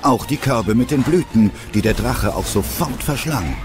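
ordinary delivery

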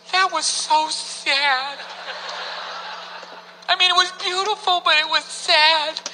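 slow, drawn-out mock-tearful wife; sad wife. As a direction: imitate a moved wife, stretching the words. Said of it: "She was like".

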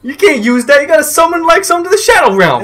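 very high, hammed-up Boston accent; mock-scolding Boston voice; Joey Wheeler. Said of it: "a Boston version of Joey Wheeler".

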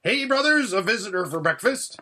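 high, loud hail; calling out. A narrator's reading. Dwarf announcing a visitor to his brothers, calling out. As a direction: call cheerily into the house. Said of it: "Duffel called out".

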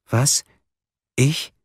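high, startled little questions. A narrator's reading. Suspect asked if she is ill right now, startled. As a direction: caught off guard, voice jumping up. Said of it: "fuhr hoch und schaute sich um".

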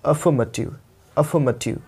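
अफर्मेटिव said with no r sound; अफर्मेटिव, r dropped, soft f; teacher's affirmative. Shown as a demonstration. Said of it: "इसको आपको अफर्मेटिव नहीं बोलना है".

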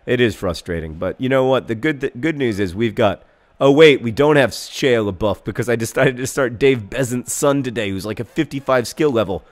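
ordinary talk, nothing marked

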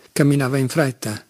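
plain reading voice

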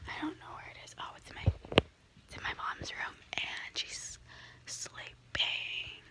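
real whisper so mom keeps sleeping. Real, not acted. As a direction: hush it so nobody wakes. Mom asleep in next room.

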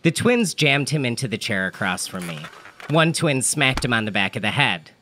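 ordinary delivery, nothing marked

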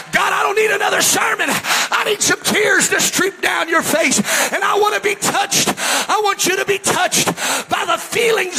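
high, loud revival preacher shout; impassioned pulpit shouting; full-force preaching. Real, not acted. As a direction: preach it at the top of your voice.

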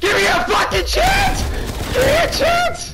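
high, loud shrieking in a frenzy; frantic high-pitched yelling; panicked screaming. Real, not acted. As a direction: scream and plead for your life at the game. Overrun by zombies, about to die.

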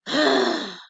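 audible exasperated sigh; frustrated sigh. Retold or imitated. Annoyed at her desk, a frustrated sigh. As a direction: huff it out. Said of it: "'cause I knew I made a mistake".

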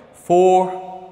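"Fortuna" in three beats, for... tu... na; syllable by syllable. Shown as a demonstration. Teacher counting syllables for pupils, syllable by syllable. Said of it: "quantas sílabas será que ela tem".

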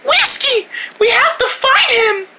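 high-pitched worried exclamation; alarmed cry. Acted. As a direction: cry out in alarm for your friend. Alarm at friend's disappearance.

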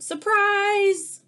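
high, loud party cry; shouting surprise at the party. Acted. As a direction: leap out and yell it.

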